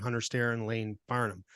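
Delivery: ordinary talk, nothing marked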